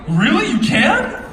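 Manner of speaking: loud, high-pitched incredulous question; mock amazement. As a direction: play up the disbelief at dad's claim. Acting out his reply to dad.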